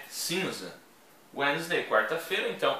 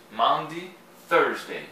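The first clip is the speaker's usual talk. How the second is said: ordinary talk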